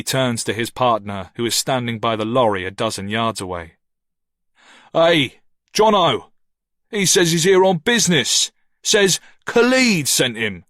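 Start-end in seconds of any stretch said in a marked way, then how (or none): 4.9-10.6 loud, higher call across the yard. A narrator's reading. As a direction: shout it over to the lorry. Ricky calls to distant partner.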